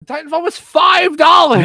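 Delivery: shrieked defense, high and loud; high mock shout. Gamer shrieks at the friend that it was cheap. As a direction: yelp it out in outrage.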